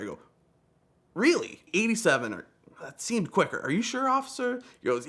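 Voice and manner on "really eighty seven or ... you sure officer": high-pitched, innocent questioning; mock disbelief. Speeder questioning the officer's figure, feigning surprise. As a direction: act puzzled and incredulous, pitched well up.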